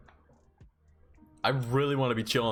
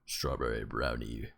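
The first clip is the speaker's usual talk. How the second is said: lower, softer and slow, a hammed-up mascot pitch; 11 semitones lower, 6 dB quieter than usual. Streamer voicing Ketter as mascot offering milkshakes, low and slow. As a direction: drop the voice low like a goofy shop mascot.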